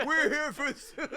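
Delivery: mock heckler. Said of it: "heckled like".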